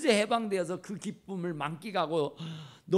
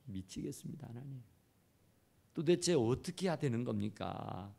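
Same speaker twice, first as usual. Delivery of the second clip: despairing prayer; 7 semitones lower, 6 dB quieter than usual. Retold or imitated. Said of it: "하는 얘기예요".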